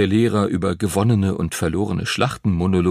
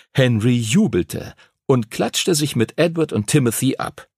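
ordinary delivery, nothing marked

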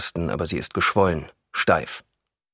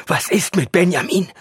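urgent, raised, high-pitched demand; almost shouting; 11 semitones higher, 5 dB louder than usual. A narrator's reading. Alarmed husband demands news of Benjamin, almost shouting. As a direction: nearly yell it, louder and higher. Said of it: "schreit Erik fast".

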